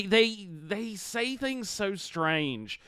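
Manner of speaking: usual speaking voice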